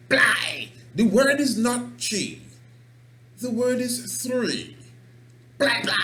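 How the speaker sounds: high, loud scolding teacher voice; furious teacher. Retold or imitated. Teacher beating a child over counting, high angry yell.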